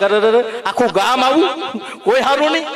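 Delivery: the swan's half-joking cry of despair; hammed-up lament. Swan in tears at village. (હંસનો નાટકીય વિલાપ)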